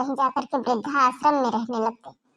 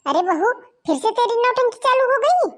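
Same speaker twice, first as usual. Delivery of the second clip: scolding; 9 semitones higher, 5 dB louder than usual. Acted. Mother-in-law scolds daughter-in-law.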